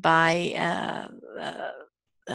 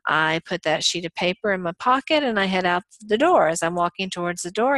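ordinary talk, nothing marked

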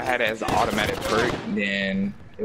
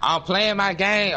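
yelling into the phone; 9 semitones higher than usual. Real, not acted. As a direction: call it out so grandma hears. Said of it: "I had to yell in the phone".

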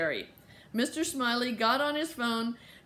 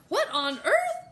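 high, loud and drawn-out disbelief; astonished exclamation; 11 semitones higher, 5 dB louder, slower than usual. A narrator's reading. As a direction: exclaim it like a baffled mother. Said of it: "exclaimed Mama".

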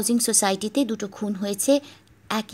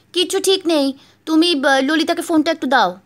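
higher, louder impatient woman; wife's voice; 6 semitones higher, 6 dB louder than usual. Acted. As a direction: brush him off as the wife. Wife impatient on phone.

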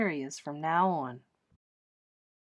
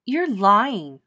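accusing; 8 dB louder than usual. Acted. Girl accusing Grace.

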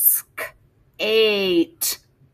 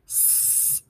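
whispered letters; 15 dB quieter than usual. Shown as a demonstration. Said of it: "Let's hear the sounds for that one".

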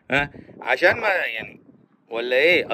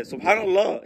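ordinary talk, nothing marked

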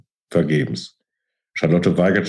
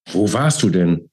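usual speaking voice

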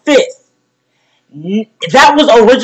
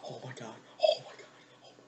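ordinary talk

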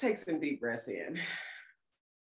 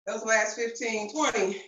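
ordinary talk, nothing marked

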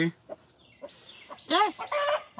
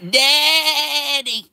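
high bleating daddy; a high goat cry of daddy; imitating a goat calling; 12 semitones higher, 5 dB louder than usual. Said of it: "He's like".

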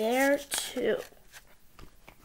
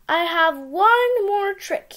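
sly firefly; 6 semitones higher, 4 dB louder, slower than usual. Acted. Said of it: "he said".

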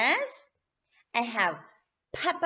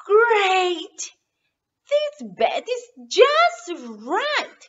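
higher, happy exclaim; delighted; 5 semitones higher, 6 dB louder, slower than usual. Acted. Goldilocks delighted with the small bed, excited. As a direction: cheer the right bed. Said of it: "she says".

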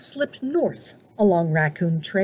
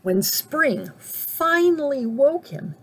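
usual speaking voice

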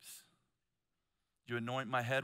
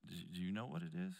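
soft, low, probing question; little inner voice; 4 semitones lower, 6 dB quieter, faster than usual. Retold or imitated. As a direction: ask it gently, like a quiet voice within. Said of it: "I heard this little voice inside of me say".

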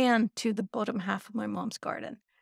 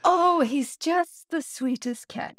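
put-on doting old man; 5 semitones higher than usual. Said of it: "So the man says".

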